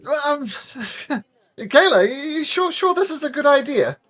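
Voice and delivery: high, hesitant nervous voice; unsure and jittery, asking for reassurance. Acted. Nervous knight before a jump.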